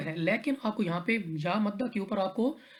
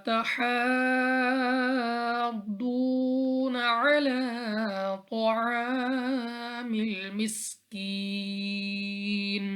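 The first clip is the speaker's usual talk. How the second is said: Quran recitation; 3 semitones higher, much slower than usual. Shown as a demonstration. Tajweed teacher recites the verse for students, slow melodic chant. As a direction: stretch every vowel in a long, sung recitation.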